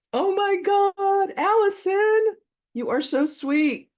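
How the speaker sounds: very high, loud, drawn-out gushing; delighted squeal. Real, not acted. Host gushing over Allison's arrival, real high squealing delight. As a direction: squeal it out, stretching every word. Greeting attendee she just spotted.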